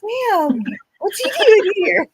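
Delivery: high, loud shocked cry; surprised. Retold or imitated. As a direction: blurt it out in shock. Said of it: "I'm like".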